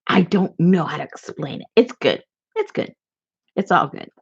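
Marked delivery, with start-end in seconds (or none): 2.5-2.9 high, soft little squeak; much higher, softer playful echo; squeaky repeat. Pretend. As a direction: echo yourself in a tiny playful voice.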